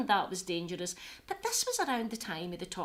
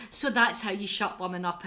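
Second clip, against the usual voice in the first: normal talking voice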